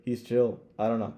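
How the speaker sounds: ordinary talk, nothing marked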